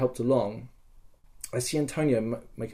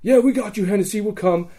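raised, high pledge of support; imitated volunteers' answer; 8 semitones higher, 3 dB louder than usual. Posse answers Hennessy's rally, raised imitated reply. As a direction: call back in eager agreement.

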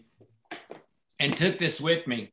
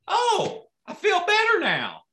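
put-on high, relieved kid voice; lightly voiced kid feeling better; 12 semitones higher than usual. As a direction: sigh out the relief like a child. Kid relieved after device.

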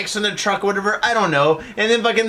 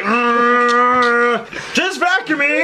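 Bobcat Goldthwait voice; 4 semitones higher, much slower than usual. Retold or imitated. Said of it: "sounding like Bobcat Goldthwait".

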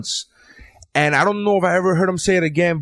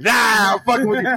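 teasing call-out; 7 semitones higher than usual. Retold or imitated. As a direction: jump up high into a playful "nah". Imitating comic's teasing reply.

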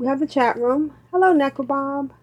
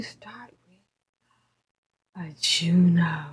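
whisper; 6 semitones lower, 5 dB quieter, much slower than usual. Acted. Dramatic intro of the Juno segment.

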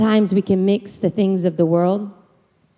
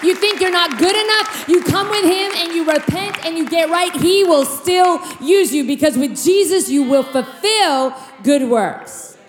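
high, urgent preaching voice; full-force preaching; 6 semitones higher than usual. Acted. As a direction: lift the congregation with fervour.

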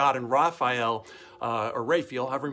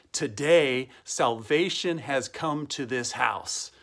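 slow, spaced-out declaration; solemn proclamation; much slower than usual. Acted. Pastor quotes Jesus to Zacchaeus, slow solemn proclamation. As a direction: proclaim it deliberately, word by word.

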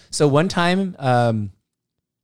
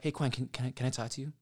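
quietly pulling someone aside, much softer; hushed aside; 4 semitones lower, 15 dB quieter, faster than usual. Retold or imitated. Coach pulls dancer aside after show, much softer hushed voice. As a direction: keep it low so only he hears.

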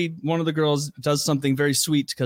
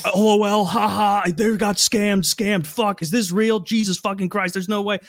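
higher, excited run of comments; chattering online audience; 6 semitones higher than usual. Retold or imitated. Twenty thousand viewers commenting on the scam, mock-gleeful. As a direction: rattle off mock viewer reactions.

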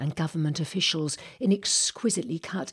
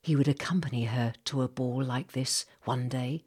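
plain reading voice

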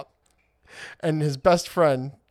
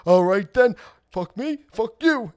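mock gangster; 8 semitones higher, 6 dB louder than usual.

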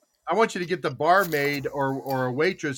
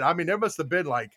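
normal talking voice

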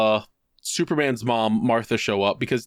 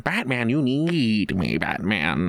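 lightly voiced, lower, slow bad Joker drawl; a half-hearted mock Joker, dragging the words; mock Joker taunting Batman, lower and slow; bad Heath Ledger Joker; 5 semitones lower, slower than usual. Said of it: "worst impression of Heath Ledger's Joker".

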